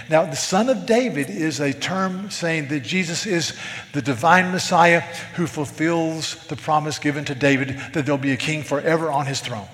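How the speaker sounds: ordinary delivery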